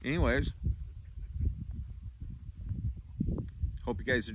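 talking around pipe stem; words through clenched teeth; pipe in mouth. Real, not acted. Seen 3: Pipe stem clenched in his mouth through nearly all the labelled words.

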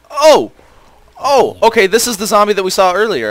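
high, loud startled yelp, then quick talk. Real, not acted. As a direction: jump at a sudden zombie, pitch shooting up. Startled by a zombie.

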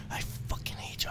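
hushed unvoiced whisper. Real, not acted. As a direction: breathe it out in a whisper. Repeated curse, whispered.

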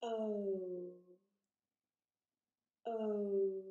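O drawn out, rounding smaller as it glides; long vowel O. Shown as a demonstration. Teacher shows how the mouth moves, O stretched long. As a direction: stretch O firmly from open to tight lips. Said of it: "O is another long vowel sound".

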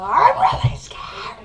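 loud burst of hammed-up fear; overplayed scared outburst; mock panic. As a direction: suddenly wail it in pretend fright.

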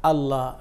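"আল্লাহ"-র লাম পাতলা করে, 'লা'; জের-এর পরে আল্লাহ উচ্চারণ. Shown as a demonstration. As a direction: say "আল্লাহ" with a thin, light l and an open "a", not "o". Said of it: "তাহলে এই অক্ষরের উচ্চারণটা হবে". (আল্লাহ শব্দের লাম চিকন করে বলা)

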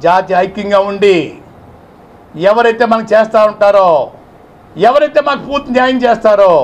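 rally the community; rousing build. Real, not acted. Leader rallying his community for justice, building loudly.

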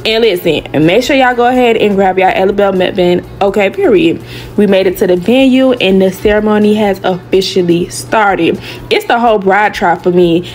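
upbeat, loud voiceover read; vlog voiceover. Acted. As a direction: hype narration over music.

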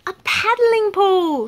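high, slow delighted squeal; excited squeak. A narrator's reading. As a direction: squeal with joy like a tiny mouse. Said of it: "squeaked Mouse excitedly".

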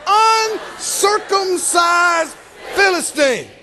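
very drawn-out, haughty chant for others to copy; high-pitched start, each word stretched scornfully; looking down the nose. Shown as a demonstration. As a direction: lead the room in lofty contempt. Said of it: "look down your nose when you say it".

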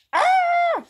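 very high, loud shriek; drawn-out high scream; pained shriek. A narrator's reading. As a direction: shriek as if bitten. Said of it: "shrieked Jafar".

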